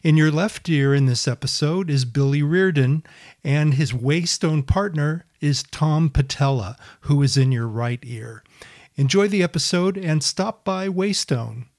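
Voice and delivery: loud, bright announcer-style podcast intro; podcast intro read. Acted. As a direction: read it like a radio show opener.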